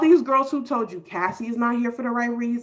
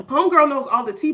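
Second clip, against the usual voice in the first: usual speaking voice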